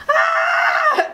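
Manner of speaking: high shouted mock scream; retold shout. Classmates as Matt falls over, shouted mock scream. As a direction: shriek as if someone just collapsed.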